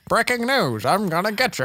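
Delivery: put-on high loud old-timey newsreel voice; 1930s newsreel announcer. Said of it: "nineteen thirties guy".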